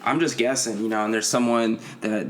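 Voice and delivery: normal talking voice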